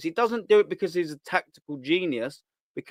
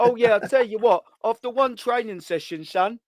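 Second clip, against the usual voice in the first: mocking Shane; 6 semitones higher, 3 dB louder than usual. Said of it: "like what Shane says".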